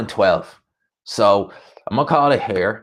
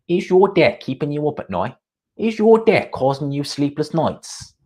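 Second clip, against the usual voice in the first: put-on Coogan's East London accent; 6 semitones higher than usual. Mocking the gambling-ad pitchman to stream viewers in a rough East London accent. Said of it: "I can't do Coogan's accent".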